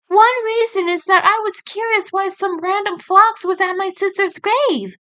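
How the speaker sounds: put-on high young female rabbit voice with a light teasing tone; joking as the sister. Sister jokes with Nick at the grave, high teasing voice. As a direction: tease the stranger gently. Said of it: "she joked".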